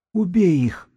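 high wheezy prompting; hoarse character's voice. A narrator's reading. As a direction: urge it in a high-pitched husky character voice. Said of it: "посоветовал Сиплый".

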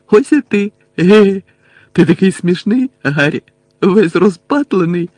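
high, loud, silly delivery; giggly, dazed Ron. A narrator's reading. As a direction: act giddy and addled, tittering between phrases. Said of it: "захихотів Рон".

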